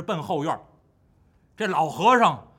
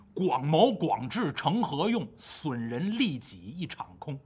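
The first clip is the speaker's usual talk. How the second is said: slow, softer, sinking to a whisper; poem recital; 3 semitones lower, 4 dB quieter, slower than usual. Acted. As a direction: recite the verse solemnly and slowly. (缓慢低沉地吟诗)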